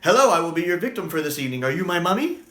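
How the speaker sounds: rattled off quickly; quick clean retake; fast delivery. Shown as a demonstration. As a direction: fire the whole line off fast. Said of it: "I can't do this really quick".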